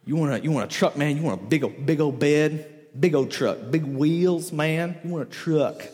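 put-on low-pitched Troy accent. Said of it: "this is just my Troy accent".

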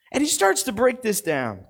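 normal talking voice

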